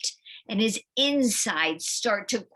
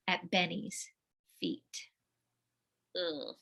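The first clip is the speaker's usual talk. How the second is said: usual speaking voice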